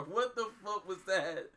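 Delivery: disbelieving question into high laughter; laughing. Real, not acted. Reacting to a brutal fight scene, laughing in disbelief. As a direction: lose it after asking. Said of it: "Nah, I'm dead".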